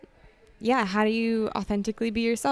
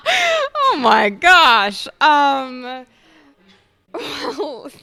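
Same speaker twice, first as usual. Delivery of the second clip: laughing; 7 semitones higher, 9 dB louder, much slower than usual. Real, not acted. Laughing at therapy joke.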